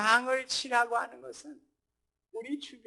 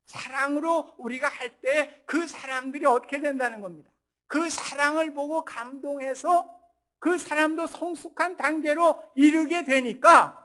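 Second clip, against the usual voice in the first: higher, louder build to a climax; impassioned preaching; 4 semitones higher, 10 dB louder than usual. Real, not acted. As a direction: preach it with mounting force. Preaching at full force.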